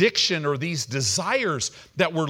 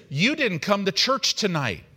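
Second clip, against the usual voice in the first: ordinary delivery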